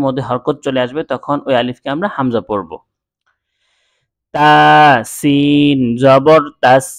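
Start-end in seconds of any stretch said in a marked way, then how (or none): 4.3-6.9 each letter and vowel mark drawn out slowly; slow spelling drill. Shown as a demonstration. Teacher spelling Arabic letters for students, slow drill. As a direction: spell it out syllable by syllable like a Quran teacher. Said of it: "এভাবে পড়ব".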